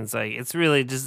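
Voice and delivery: normal talking voice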